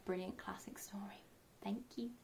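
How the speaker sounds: soft, quick whisper; whispered goodbye. Real, not acted. Teacher whispering goodbye to children. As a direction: whisper goodnight.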